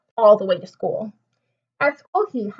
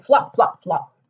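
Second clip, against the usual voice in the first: plain reading voice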